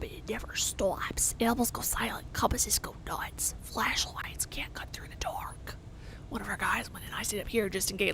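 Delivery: hushed, secretive whisper. Acted. NPC whispering about the hole.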